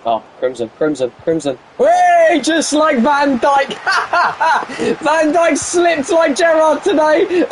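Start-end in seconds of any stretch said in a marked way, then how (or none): 1.8-7.4 high, slow, laughing shout; mock the slip like a gleeful pundit; laughing mock commentary. Real, not acted. Streamer laughing at a defender's slip, high slow mocking shout.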